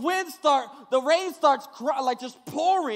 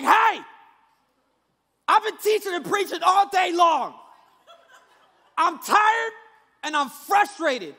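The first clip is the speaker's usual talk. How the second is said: loud, higher exasperated rebuke; frustrated Jesus; 4 semitones higher, 5 dB louder than usual. Retold or imitated. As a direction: scold the storm, fed up and tired. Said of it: "He's like".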